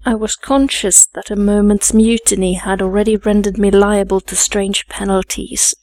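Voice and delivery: ordinary delivery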